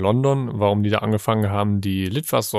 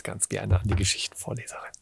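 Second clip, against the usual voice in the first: whispering; 2 semitones lower, 10 dB quieter than usual. Real, not acted. Hands over to the storyteller.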